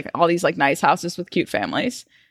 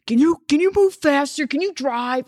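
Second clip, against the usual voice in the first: put-on drunk urging; 7 semitones higher than usual. Drunk boyfriend urging Uber driver.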